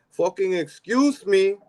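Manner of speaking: high, slow, drawn-out imitation; New York man's outburst. Quoting a wise man in New York, high mock yell. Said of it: "A wise man in New York once said".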